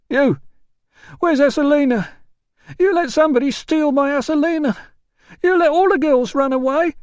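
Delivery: put-on very high, loud drunken wail; drunk yelling. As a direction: howl accusations at the doormen. Drunk porter shouts at staff.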